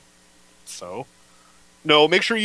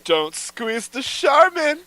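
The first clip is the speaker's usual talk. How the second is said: mock Pauly Shore, slowed and higher; Pauly Shore style; 8 semitones higher than usual. Friend asks for it Pauly Shore style, mock delivery. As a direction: ham up the ad line like Pauly Shore. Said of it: "do that Pauly Shore style".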